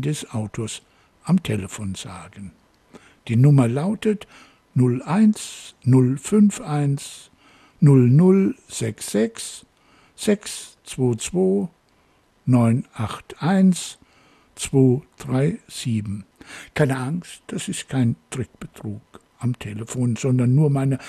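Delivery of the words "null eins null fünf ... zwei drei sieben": digits read out slowly in groups; slow dictation. Real, not acted. Host gives listeners his phone number to note down, slow dictation. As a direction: dictate each group, pausing between them.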